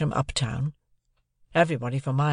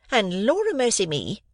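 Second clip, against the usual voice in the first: higher exasperated exclamation; desperate; 8 semitones higher than usual. A narrator's reading. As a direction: throw up your hands. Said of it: "in sudden desperation".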